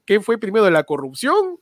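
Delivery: usual speaking voice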